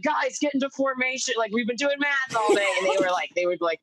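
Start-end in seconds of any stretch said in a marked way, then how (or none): 0.0-2.7 higher, hurried mock command to the class; rally the kids before the principal walks in; urgent teacher call. Teacher hurrying her class.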